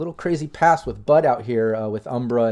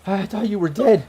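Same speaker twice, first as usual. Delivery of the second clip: acted tearful voice, higher; crying; 8 semitones higher, 3 dB louder than usual. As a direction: choke it out to a lost love. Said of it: "And she's just crying".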